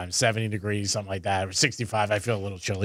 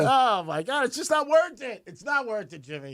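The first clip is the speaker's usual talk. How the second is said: exasperated; 10 semitones higher than usual. Acted. Mock exasperation continues.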